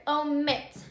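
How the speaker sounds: omit with the second syllable MIT stressed; stress on last syllable. Shown as a demonstration. Teacher says the word for students to hear, "omit" stressed on MIT. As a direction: say "omit" leaning on the final MIT. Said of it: "that is the strongest syllable".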